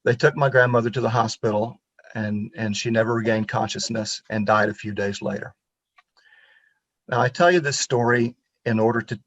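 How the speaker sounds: normal talking voice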